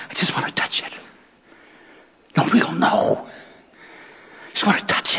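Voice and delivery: soft unvoiced whisper, nobody must know; whispered secret. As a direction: whisper as if hiding it. Woman's secret inner thought.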